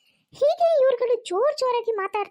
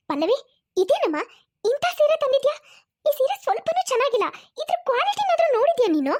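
scolding mother-in-law; 5 semitones higher than usual. Acted. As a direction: scold her sharply over the cheap saree. Mother scolds daughter-in-law over saree. (ಕೋಪದ ಗದರಿಕೆ)

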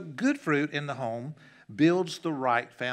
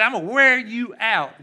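shouted threat, much higher and louder; father shouting a threat at his son; angry shout; 8 semitones higher, 12 dB louder than usual. Retold or imitated. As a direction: yell it at the defiant boy. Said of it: "I said".

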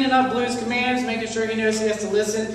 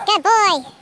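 squeaky praising a dog; 9 semitones higher, 5 dB louder than usual. Real, not acted. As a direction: coo it up high to the dog. Owner praising dog high.

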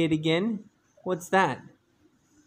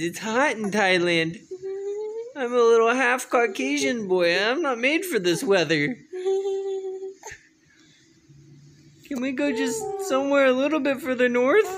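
put-on lightly higher, quicker baby voice, a touch of whine; voicing the baby; 8 semitones higher, much faster than usual. Dad voicing the baby's complaint about the heat, mildly whiny and quick. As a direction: speak for the baby in a mildly fussy, hurried voice. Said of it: "he says".

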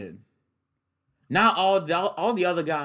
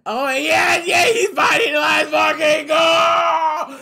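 high, loud, slow yelling; hyped shout; 13 semitones higher, 9 dB louder, slower than usual. Real, not acted. Fan hyped about the fight scene, high slow yell. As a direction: yell it with total hype.

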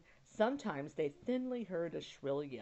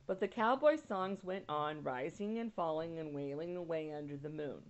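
usual speaking voice

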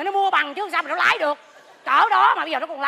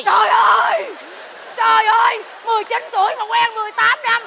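high, loud cries of disbelief; hammed-up gossip shock; scandalized shock; 6 semitones higher, 7 dB louder than usual. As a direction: exclaim loudly, pitch up, utterly stunned. Shocked at gossip about an affair.

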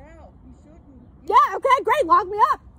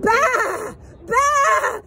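sheep bleat; 2 semitones higher than usual. Pretend. Calling people sheep, bleats.

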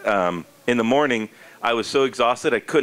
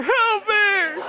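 panicked cry. Retold or imitated. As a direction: yelp helplessly in mock panic. Retold panic, stuck in jersey.

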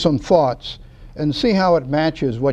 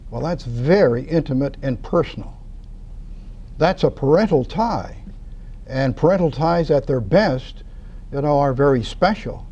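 ordinary talk, nothing marked

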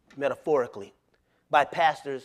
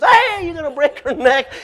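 alarmed wife; 11 semitones higher, 9 dB louder than usual. Retold or imitated. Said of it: "Tina goes".

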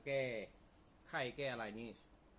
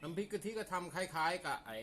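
louder; 6 semitones higher, 5 dB louder than usual. Real, not acted. Loud emphatic explanation.

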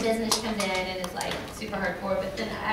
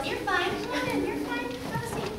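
mock welcoming; 12 semitones higher than usual. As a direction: wave them in with a high little voice. Acted welcome to late arrival.

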